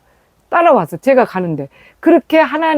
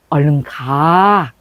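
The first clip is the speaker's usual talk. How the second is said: lower, very slow and gentle; calm a worried daughter from behind; slow tender reassurance, voice dropped low; soft fatherly voice; 7 semitones lower, much slower than usual. Retold or imitated. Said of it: "그 부드러운 그 소리".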